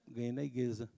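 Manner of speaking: put-on a small boy answering quietly; lightly voiced toddler. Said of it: "daquele jeito de criança falar".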